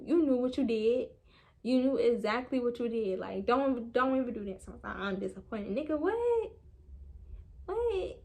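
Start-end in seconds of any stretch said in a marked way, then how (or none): 5.8-8.2 outraged disbelief. Retold or imitated. Reliving outrage at boyfriend.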